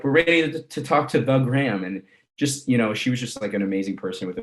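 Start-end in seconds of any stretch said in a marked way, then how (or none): 0.0-1.8 high eager voice; keen, pitched up. Retold or imitated. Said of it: "they're like".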